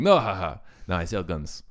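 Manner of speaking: put-on casual Russian-accented aside; deep Russian accent. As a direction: shrug it off like a Russian arms dealer. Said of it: "doing an actual, like, deep Russian accent".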